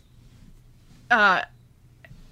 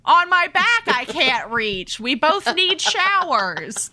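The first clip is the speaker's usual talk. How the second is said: higher, slow flirty invitation; slowly bait him with a higher voice; mock seductive offer; flirty heroine; 5 semitones higher, 4 dB louder, slower than usual. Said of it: "she was like".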